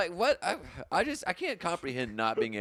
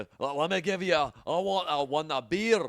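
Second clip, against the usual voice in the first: mock Scottish accent; 2 semitones higher, 3 dB louder than usual. Scottish Stone Cold ordering beer, mock Scottish accent. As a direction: order drinks as a hammed-up Scot.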